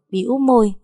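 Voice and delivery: plain reading voice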